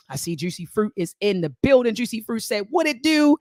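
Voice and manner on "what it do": put-on high, loud Baltimore-accented greeting. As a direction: call it out big in a Baltimore accent. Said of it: "in that Baltimore accent".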